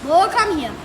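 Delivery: loud, high call to a dog. Real, not acted. Calling the dog.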